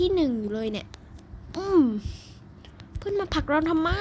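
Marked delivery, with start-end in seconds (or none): 3.0-3.9 whiny put-on voice. Mock complaint in put-on voice.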